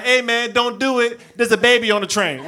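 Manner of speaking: high, loud mock plea; alarmed pleading. Friend at the back begging his buddy to stop, loud and high. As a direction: call out urgently to stop him.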